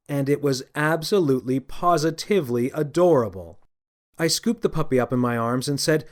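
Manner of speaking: usual speaking voice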